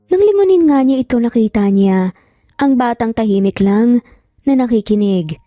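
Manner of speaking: ordinary delivery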